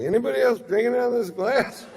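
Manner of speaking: put-on high, fast drunken disbelief; drunk confusion. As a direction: blurt it, baffled and tipsy. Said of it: "I'm like".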